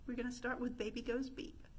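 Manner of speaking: ordinary delivery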